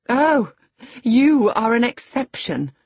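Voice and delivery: high, loud, cutting sarcasm; scornful retort. A narrator's reading. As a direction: cut them down haughtily. Lady cuts Earnshaw down in quarrel.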